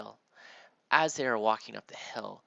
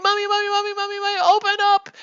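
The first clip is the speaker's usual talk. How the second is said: high, loud panicked shouting for mommy; frantic kids yelling; 16 dB louder, faster than usual. Pretend. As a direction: scream for mom to let you in. Kids pounding on door.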